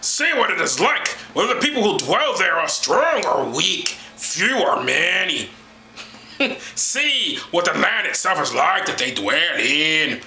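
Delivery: drawn-out, hammed-up prophet's oration; Moses. Mocking Bible reader instructing the spies, slow theatrical oration. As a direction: stretch the line endings like a grand patriarch. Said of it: "This is my Moses voice".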